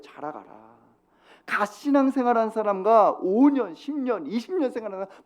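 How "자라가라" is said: "자라가라"를 낮고 조용하게, 끝을 살짝 올려서; "자라가라" 한마디를 낮게 속삭이듯 되뇌며 끝을 올리기; quiet. Shown as a demonstration. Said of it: "자라가라는 거예요". ("자라가라"를 낮고 조용히, 끝을 올려 되새기듯)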